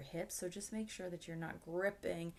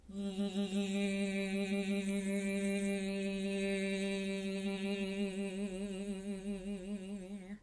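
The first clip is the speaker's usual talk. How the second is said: a long, helpless giggle at herself; long real laugh. Real, not acted. Teacher laughing at her own silly warm-up, long giggle. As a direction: let the laugh run on, amused at the silliness.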